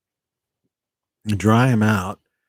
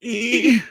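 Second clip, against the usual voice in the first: high giggle; 12 semitones higher than usual. Real, not acted. Laughing in alien voice.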